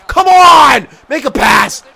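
high, loud demand; exasperated shout. Real, not acted. Player urging teammates to pass, exasperated shouting. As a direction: bellow it at teammates.

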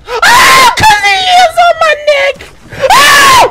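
very high, loud, rapid shrieking; frantic full-voice shriek; panicked screaming. Real, not acted. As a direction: scream as if about to be caught. Caught up by pursuer in game.

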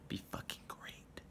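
mock-intimate whisper, slow. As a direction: breathe it like a secret. Teasing invite to meet up.

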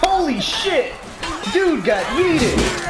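high-pitched shocked outburst; surprised exclamation. Real, not acted. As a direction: react to something wild happening. Stunned by a kill.

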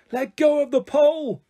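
high, loud call from far below; shout from below. Acted. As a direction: yell up to a friend in the air. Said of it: "she heard Enid shout".

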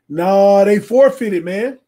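loud protest. Real, not acted. Insists loudly the opponent forfeited.

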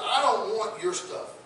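lightly voiced Daniel refusing the king; defiant Daniel. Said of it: "I can see Daniel looks at him and says".

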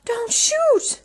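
high, loud panicked shout; yelled. A narrator's reading. Cindy begging the godmother not to fire, shouting. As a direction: cry out to stop her. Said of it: "and Cindy yelled".

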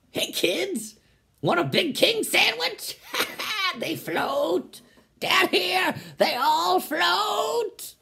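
high creepy clown voice, laughing; Pennywise. Pretend. The clown offers kids a sandwich from the sewer, creepy high luring voice. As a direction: lure them like a sewer clown.

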